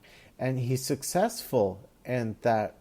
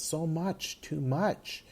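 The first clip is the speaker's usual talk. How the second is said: breaking down; 6 semitones higher, 4 dB quieter, slower than usual. Retold or imitated. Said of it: "the Spectre actually breaks down".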